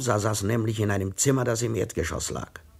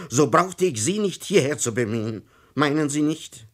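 loud, high sarcastic rant; a fed-up old gentleman lecturing the policeman; exasperated outburst; 7 semitones higher, 5 dB louder than usual. A narrator's reading. Tomsa loses patience with inspector. (gereizter, lauter Ausbruch)

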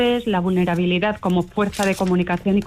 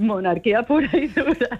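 laughing; 3 semitones higher than usual. Real, not acted. Jokes about royal cast.

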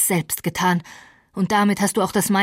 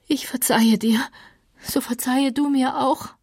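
lightly voiced frail woman, higher; a dying woman making peace, pitched up; gently higher, resigned Catherine; Catherine, weary and forgiving; 5 semitones higher than usual. A narrator's reading.

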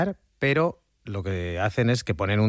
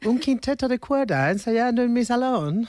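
put-on high-pitched old lady with English accent; elderly English lady; 12 semitones higher than usual. English lady delighted by musicians in her parlour, high put-on old-lady voice. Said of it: "Soy una señora mayor inglesa".

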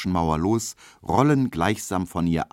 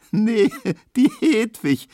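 high, louder squeal through a laugh; squealing with laughter; 6 dB louder, slower than usual. Acted. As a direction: squeal with delight at the joke. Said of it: "quiekt Emma". (Emma quiekt lachend hoch)

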